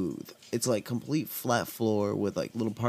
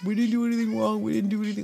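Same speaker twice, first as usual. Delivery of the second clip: high, panicky pleading from a scared stoned friend; voicing a freaked-out buddy, pitched way up; panicked friend; 11 semitones higher, faster than usual. Stoned friend panicking at the cops, high pleading. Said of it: "starts, like, freaking out".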